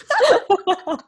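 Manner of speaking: high, loud burst of laughter; delighted laugh. Real, not acted. Real laughing at promised singing.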